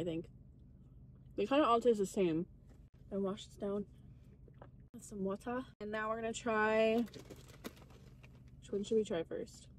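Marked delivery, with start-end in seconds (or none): 0.0-7.0 talking between bites; chewing. Real, not acted. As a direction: mouth partly full. Seen 2: bites cheese at 1.3s, then talks while chewing.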